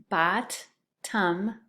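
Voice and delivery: hap-py split into two beats, each syllable tapped. Shown as a demonstration. Teacher to second graders, splitting hap-py into syllables. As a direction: tap out hap-py slowly, one syllable at a time. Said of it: "The next one is hap-py".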